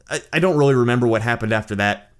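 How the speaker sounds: ordinary talk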